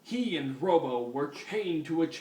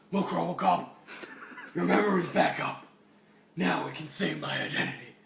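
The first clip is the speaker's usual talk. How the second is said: Batman voice. Acted. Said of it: "I mean".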